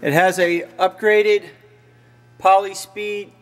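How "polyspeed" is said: usual speaking voice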